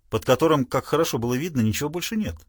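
ordinary delivery

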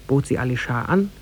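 usual speaking voice

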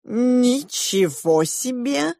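high, very slow drawn-out wonder; amazed. A narrator's reading. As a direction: stretch it out in high-pitched amazement. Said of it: "ахнул Матиас".

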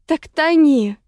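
high, loud retort; angry yell. A narrator's reading. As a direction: throw it back at her in fury. Quarrel while one is drowning.

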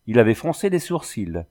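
plain reading voice

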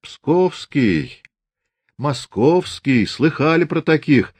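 jeering at the prisoner's home region; mocking. A narrator's reading. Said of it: "насмешливо сказал Фомин".

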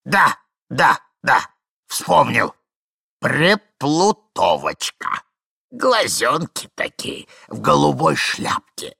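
loud start, then lingering fondness; delighted old man. Acted. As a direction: relish each remembered detail slowly. Plays old man remembering.

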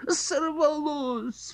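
high drawn-out cry of dismay. Acted. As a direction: cry out at the lost fish. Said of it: "вскрикнули".

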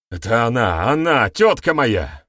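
loud, climbing blame; panicked confession. A narrator's reading. Accuser puts the blame on his aunt, panicked. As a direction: blurt it out desperately. Accuser breaks and names the culprit.

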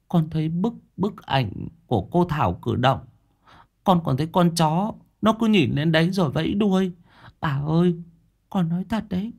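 put-on high, earnest child voice; scared little boy. A narrator's reading. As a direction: insist to grandma like a frightened child. Scared boy tells grandma what moved.

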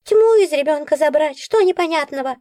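high, snappy creature bark. A narrator's reading. Nora snapping at the heroine, high bark. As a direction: snap at her impatiently. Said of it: "рявкнула Нора".

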